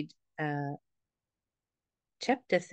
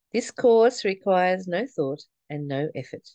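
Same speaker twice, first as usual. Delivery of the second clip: ordinary talk, nothing marked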